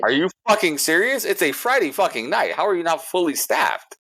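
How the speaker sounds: high-pitched, incredulous angry outburst; going off. Retold or imitated. Diner challenging understaffed restaurant on a Friday night, going off angrily. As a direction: lay into them in disbelief, pitch jumping up. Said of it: "I just started going off".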